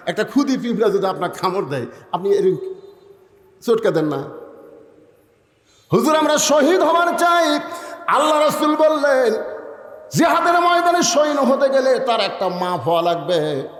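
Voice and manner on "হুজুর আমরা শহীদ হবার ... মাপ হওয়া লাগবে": high, sustained preacher's cry; full-force preaching. A narrator's reading. Preacher retelling the boys' plea for martyrdom, high impassioned delivery. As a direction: lift the tale high, easing towards the end.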